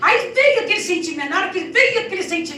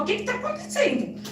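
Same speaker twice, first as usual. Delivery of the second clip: worried doctor; 9 semitones higher, 3 dB quieter than usual. Retold or imitated. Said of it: "ela falou".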